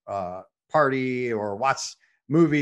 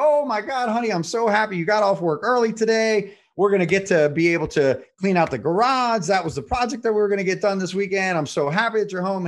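excited wife; 6 semitones higher than usual. Retold or imitated. Husband retells wife's delight, high and excited. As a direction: gush like a thrilled wife. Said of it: "He's like".

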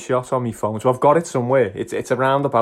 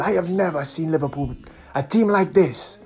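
imitated Nigerian fan; 4 semitones higher than usual. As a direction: rave in an imitated Nigerian accent. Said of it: "fantastic Nigerian guy, full of life".

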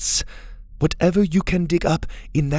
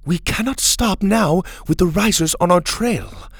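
higher, insistent refusal; firm dismissal; 8 semitones higher, 3 dB louder than usual. A narrator's reading. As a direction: shut the idea down firmly. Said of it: "Mora dismissed the idea".

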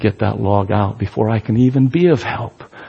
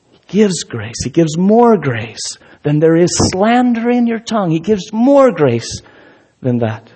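building preacher's cry; 8 semitones higher than usual. Acted. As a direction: build it up, climbing on more grace. Preacher rising to a climax.

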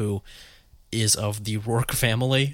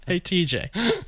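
mock casual message; 8 semitones higher than usual. Mock DM to a player.

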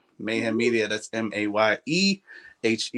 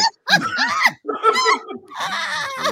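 loud high cackle; 3 dB louder than usual. Real, not acted. Cackle after review talk.

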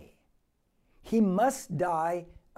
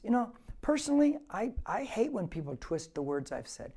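usual speaking voice

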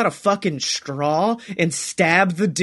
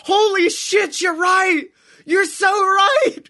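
excited shout; 12 semitones higher, slower than usual. Real, not acted. Host realising the cohost is right, laughing excited shout. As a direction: shout it like he just got it.

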